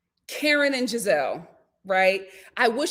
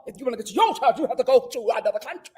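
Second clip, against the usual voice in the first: imitating [name]'s accents; 5 semitones higher, 11 dB louder, faster than usual. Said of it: "She was like".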